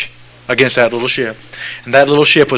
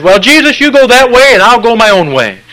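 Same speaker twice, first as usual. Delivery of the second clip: mock stubborn follower; 10 semitones higher, 7 dB louder than usual. Mocking folks who refuse Jesus.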